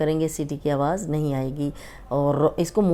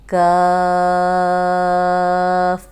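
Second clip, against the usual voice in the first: slow, drawn-out recitation, a bit louder; teacher modelling letters slowly; tajweed demonstration; 7 dB louder, much slower than usual. Shown as a demonstration. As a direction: stretch the letter names as a model for pupils. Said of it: "یہ دیکھیں".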